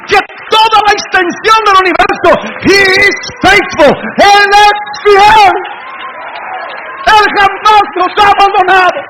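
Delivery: high, loud triumphant proclamation; full-force preaching. Real, not acted. Preacher proclaiming the faithful God he knows, full-force shouting. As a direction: shout it out to the whole congregation.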